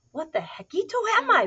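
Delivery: high, loud, baffled question; wondering aloud. A narrator's reading. Kitten arriving at a strange shack, baffled. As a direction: wonder it in big cartoon bafflement. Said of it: "wondered Skippy, Scapito aloud".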